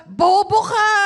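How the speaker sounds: lightly acted family scolding; insulting relative. (kunwaring pang-iinsulto)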